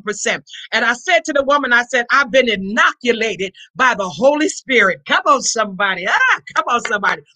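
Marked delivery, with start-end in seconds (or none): none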